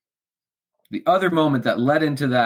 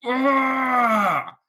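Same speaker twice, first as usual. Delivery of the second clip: raging cry; 12 semitones higher than usual. Retold or imitated. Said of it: "I was like".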